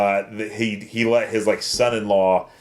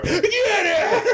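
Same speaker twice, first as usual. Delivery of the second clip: very high squeaky laughing yell; mock yell. Cohost echoing the angry boss, laughing. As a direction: ham up the boss's shout.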